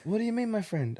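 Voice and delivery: high, eager hawker calling out; mock the sellers' pushy welcome; hammed-up street vendor greeting; market seller voice. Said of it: "seller voice".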